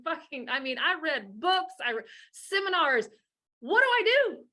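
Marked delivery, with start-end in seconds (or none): 3.6-4.5 high, louder pleading question; hammed-up helpless cry; mock desperate parent. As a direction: play a frantic lost parent. Mock desperate parent asking for help.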